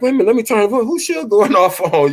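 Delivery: surprised. Retold or imitated. Retold reaction to the live stream.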